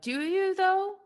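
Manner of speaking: mocking retort. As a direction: jump up into a silly high voice to poke fun. Mocking voice of the collective consciousness.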